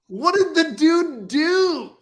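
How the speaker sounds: retelling the shocked reaction on the phone; incredulous question. Retold or imitated. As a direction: stretch out the astonished question. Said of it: "I was like".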